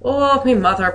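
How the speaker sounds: best attempt at an Irish accent; hammed-up Irish accent for an example sentence. Shown as a demonstration. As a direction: put on a playful Irish lilt. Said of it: "use my best Irish accent here".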